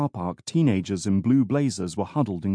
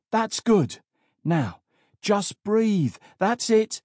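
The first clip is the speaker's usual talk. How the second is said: urgent coaxing; 11 semitones higher, slower than usual. A narrator's reading. Officer talks the struggling boy down.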